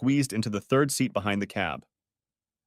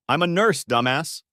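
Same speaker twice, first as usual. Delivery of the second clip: higher, louder sharp snap; annoyed retort; 5 semitones higher, 5 dB louder than usual. Acted. Lourdes corrects Kevin, an irritated snap. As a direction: put him firmly in his place.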